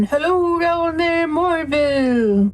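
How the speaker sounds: long high drawn-out call down a hole; mock holler. Player calling down an open grate, mock holler. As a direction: holler it down a shaft without real volume, vowels stretched. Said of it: "stick my head in and holler down".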